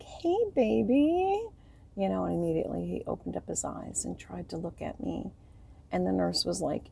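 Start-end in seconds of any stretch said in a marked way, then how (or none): 0.0-1.5 high, slow, tender hello; tender greeting. Retold or imitated. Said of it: "I was like".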